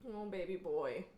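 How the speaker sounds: soft cooing to a pet. Real, not acted. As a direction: coo softly at the cat. Cooing to the cat.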